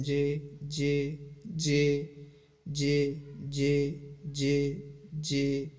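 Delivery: slow, drawn-out chē repeated while writing; sounding out letters. Shown as a demonstration. Teacher showing students how to say each letter while writing, slow drawn-out syllables. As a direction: stretch each चे as the pencil moves. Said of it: "बोल बोल कर आपने हर stroke को लिखना है".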